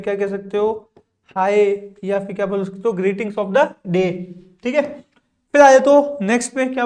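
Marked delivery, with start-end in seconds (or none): 2.9-4.1 slow model phrase. Shown as a demonstration. Teacher models new greeting slowly.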